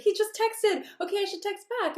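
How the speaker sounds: high, breathless-with-news mock excitement; flustered client. Anxious client tells her coach he texted, mock flustered.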